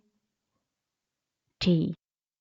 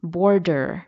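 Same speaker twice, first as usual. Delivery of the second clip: बॉर्डर with an American r-coloured ending, "borDer"; American touch; 2 semitones lower, slower than usual. Shown as a demonstration. Teacher showing learners बॉर्डर with an American accent. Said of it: "थोड़ा सा American touch".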